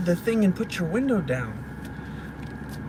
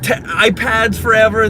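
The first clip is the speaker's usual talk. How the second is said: ordinary talk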